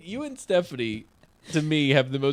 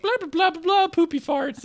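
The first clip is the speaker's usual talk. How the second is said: silly goofy babble; 3 dB louder than usual. Retold or imitated. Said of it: "She's like".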